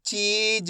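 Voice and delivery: चीज with long ee and light final j; half-sounded last letter. Shown as a demonstration. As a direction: hold ची, then release only half of ज in चीज. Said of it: "last वाले अक्षर का उच्चारण अपन आधा करेंगे".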